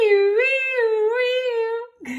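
very high siren wail; high-pitched wee wee siren; siren noise. A narrator's reading. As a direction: wail like a tiny ambulance siren. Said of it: "good and loud".

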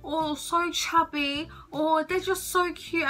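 gushing over babies. Real, not acted. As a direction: melt over how tiny and chubby they are. Gushing over how cute babies are.

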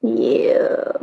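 flat breathy yeah; barely bothered, almost unvoiced; unenthusiastic. Real, not acted. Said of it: "You don't sound very excited".

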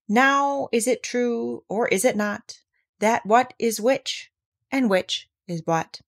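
slow, measured verse with lifted line openings; ponderous storybook poetry reading; poem recitation. A narrator's reading. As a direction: recite it deliberately, drawing out the rhymes.

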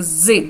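"з" as a buzzing, voiced sound. Shown as a demonstration. Teacher showing the other reading of esse, voiced "з". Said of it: "інколи вона читається як".

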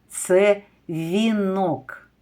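це вінок slow with weight on це; slow confirming answer for children; slow stressed answer. Shown as a demonstration. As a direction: slowly confirm це вінок, leaning on це. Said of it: "Які ви розумнички".

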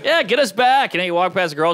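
ordinary talk, nothing marked

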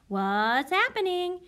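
high, slow, silly penguin asking; teacher repeats the penguin's line in character; Tacky's voice. Acted. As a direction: ham up a puzzled cartoon penguin. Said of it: "He's asking a question".

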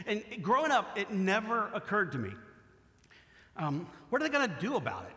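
usual speaking voice

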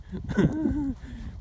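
short high-pitched laugh; high giggle; real laugh. Real, not acted. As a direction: laugh at someone's bad luck. Laughs at the failed contestant.

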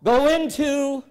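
a slow booming prompt for the congregation; rising call. Shown as a demonstration. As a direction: draw the crowd into the response. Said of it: "repeated call to congregation".

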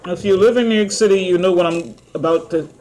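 chewing while talking; eating between words; mouth full of fries. Real, not acted. Seen 3: Holds fry, mouth works between words, then brings fry to mouth.

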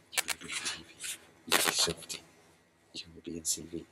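low, soft cooing to a dog; soft pet talk. Real, not acted. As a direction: murmur it tenderly to the puppy. Cooing to the puppy.

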